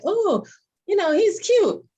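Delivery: high, lilting retold admiration; admiring coo. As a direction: coo it high, like spotting someone cute. Retold admiring remark about guy friend.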